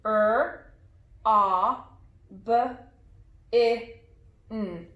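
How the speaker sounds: each sound said apart, with pauses. Shown as a demonstration. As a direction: sound out the word letter by letter. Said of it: "Let's say the sounds in this word".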